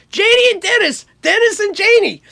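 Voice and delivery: high, loud calling of names; imitated exasperated producer. Producer to the two songwriters, high imitated exasperation. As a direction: act out a producer pulling the duo up. Producer addressing the duo, acted.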